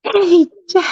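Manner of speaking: high squeaky voice; chipmunk-high calling out; helium voice. Pretend. Squeaky voice game.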